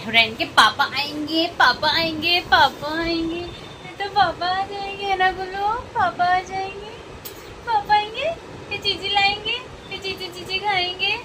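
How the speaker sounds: very high cooing voice. Real, not acted. Mother telling baby papa is coming, high baby talk.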